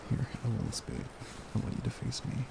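ordinary talk

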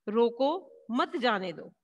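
slow reading, "रोको" set apart from "मत जाने दो"; pause after रोको. Shown as a demonstration. Teacher asks pupils to listen closely, pause after "रोको". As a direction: stop after "रोको", then run "मत जाने दो" together. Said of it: "इस शब्द वाक्य को पढ़ें बेटा".

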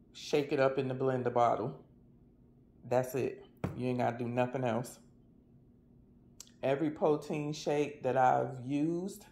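ordinary delivery

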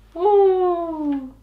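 high, loud whoop of flight; gleeful woo. Acted. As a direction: whoop as they shoot up. Flying up the chimney.

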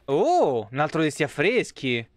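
ordinary talk

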